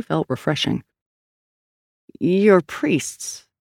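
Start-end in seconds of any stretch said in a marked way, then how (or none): 2.2-3.5 mildly doubting detective; skeptical. A narrator's reading. Detective doubts the strangers are priests, mildly skeptical. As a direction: question it with a touch of disbelief. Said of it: "Sal said, skeptical".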